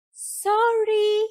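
high, drawn-out character voice; a sing-out reply called over the shoulder; Pooh calling back. A narrator's reading. Pooh hurries past Rabbit, calling back. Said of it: "Pooh called back".

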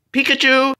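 high pretend call. Calling Pikachu during toy play, calling out.

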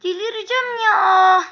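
half-shouting "I'll go mad" at the game; exasperated cry. Real, not acted. Losing again, exasperated gamer. (oyuna isyan eden yarı bağırış)

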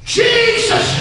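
very high, drawn-out call; cranked-up shout. Acted. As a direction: crank it up and cry out. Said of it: "He crunk it up".

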